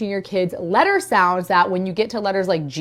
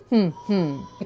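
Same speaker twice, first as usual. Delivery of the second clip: humming syllables; 5 dB quieter than usual. Shown as a demonstration. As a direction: hum each syllable of butterfly. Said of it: "they're just going to hum the word".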